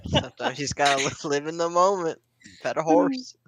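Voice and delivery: mock Forrest Gump. Forrest Gump style quote.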